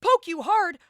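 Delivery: high-pitched sarcastic comeback, slowing at the end; indignant retort. Retold or imitated. Wife retelling her reply to poked husband, indignant. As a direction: snap back in mock outrage.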